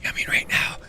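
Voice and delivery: hushed whisper. Retold or imitated. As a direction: whisper it, pushing gently. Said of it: "I'll whisper to you".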